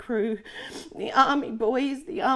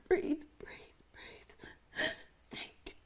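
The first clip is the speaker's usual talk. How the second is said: soft whisper, pushing through panic; whispered self-calming; 6 semitones higher, 11 dB quieter, much slower than usual. Acted. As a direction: whisper to steady your breath.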